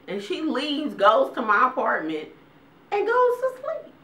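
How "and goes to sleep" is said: incredulous punchline. Real, not acted. Punchline about mom sleeping.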